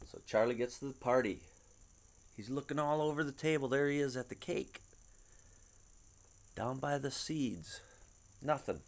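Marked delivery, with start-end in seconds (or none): none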